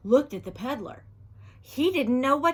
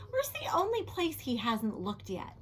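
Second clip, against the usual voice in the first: plain reading voice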